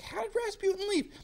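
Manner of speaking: high, squeaky bewildered question; baffled disbelief. Retold or imitated. Yusupov baffled by the empty room, high bewildered squeak. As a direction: ask it high in total confusion. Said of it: "He's like".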